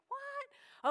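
high, sharp, disbelieving what; incredulous what. Retold or imitated. Pastor retelling his warranty mail, incredulous what. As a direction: react to the upsell in disbelief.

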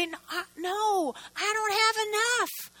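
hesitate, then refuse timidly; hesitant widow. Retold or imitated. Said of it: "Or am I gonna say".

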